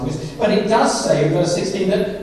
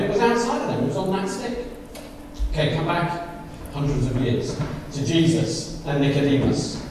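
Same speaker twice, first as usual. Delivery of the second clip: ordinary talk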